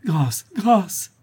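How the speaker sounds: high, drawn-out plea for mercy; desperate appeal; pleading cry. A narrator's reading. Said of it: "s'écria Louise".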